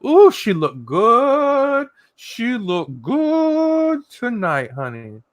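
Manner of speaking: high, slow, drawn-out admiring croon; imagined hubby voice. Acted. Imagining hubby admiring his wife, hammed-up crooning voice. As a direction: stretch GOOD out long and high, hammed up. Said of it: "that's how he sounds".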